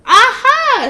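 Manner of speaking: loud high triumphant aha; shout. Acted. Witch shouting as she gets an idea. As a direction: leap on it with a shout. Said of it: "she shouted with a jump".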